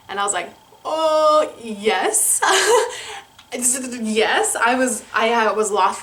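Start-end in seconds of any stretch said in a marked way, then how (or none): 0.8-2.4 higher, louder gasp of joy, laughing into the yes; thrilled yes. Retold or imitated. Retelling how she answered his proposal, thrilled and laughing. Accepting the marriage proposal, retold. 2.5-3.2 high, bright burst of laughter; giddy laugh. Retold or imitated. Laughing after accepting his proposal, giddy laugh.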